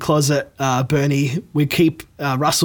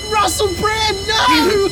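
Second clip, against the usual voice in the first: burning scream; slower than usual. Acted. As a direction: scream as if on fire. Celebrities being incinerated.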